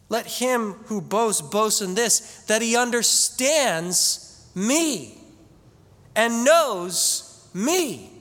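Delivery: slow, solemn scripture reading with long pauses. Acted. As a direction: lay each line out weightily, like a sermon text. Pastor quotes scripture again.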